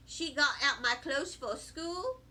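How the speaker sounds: ordinary delivery, nothing marked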